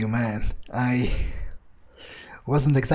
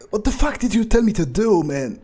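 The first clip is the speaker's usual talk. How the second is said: outraged yell; 8 semitones higher than usual. Acted. Fledgling yells at the mentor after the foul feeding, outraged. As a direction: rage at him for the order.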